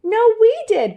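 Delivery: high, loud claim; loud insistent cry. A narrator's reading. The sisters loudly claiming the rescue. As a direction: shout to take the credit. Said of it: "cried Lolly and Molly".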